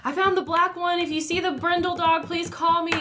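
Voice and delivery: high, urgent imitation; imitated anxious owner. Dog owner messaging about brindle dog, anxious imitation.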